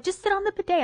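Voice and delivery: mom impression. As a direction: mimic a dismissive mom. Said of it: "my mom's like".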